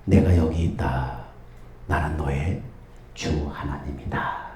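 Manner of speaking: a distant divine voice from above; God answering the traveler quietly. Acted. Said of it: "어떤 소리가 들립니다".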